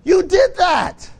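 high, loud, slow mock scolding; mock shout. Telling the crier the bill is their own doing, a slow mock yell. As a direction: drag it out like a stern rebuke. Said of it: "I'm like".

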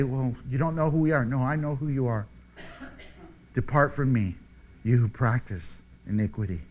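low, soft, solemn dismissal; hushed final verdict; grave judgment. Acted. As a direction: pronounce the sentence quietly and low. Acted judgment by the Lord.

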